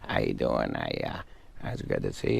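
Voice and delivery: soft, low mumble impression; understated mumbled greeting, trailing upward at the end; Adam Sandler mumbling. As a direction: mumble it quietly as a shy comedian impression. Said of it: "where he just kinda mumbles".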